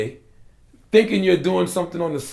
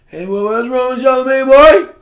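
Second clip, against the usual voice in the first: put-on high, loud country-boy holler; 11 semitones higher, 13 dB louder than usual. Friend hollering about him in country talk, loud and high. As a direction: belt it out like a South Carolina country boy. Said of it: "in the country, that's how we used to talk".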